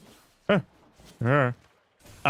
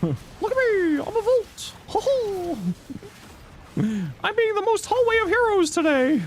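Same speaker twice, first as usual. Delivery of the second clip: giddy high creature voice gushing; high squeaky cartoon critter. Playing hammy Volt character.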